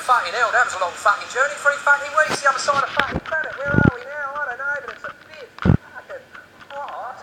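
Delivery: hammed-up Cockney convict moaning about the trip; convict Cockney accent. Convicts getting off boats in Australia, in a Cockney accent. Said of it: "the Australian accent is just the convict Cockney accent, slowed down".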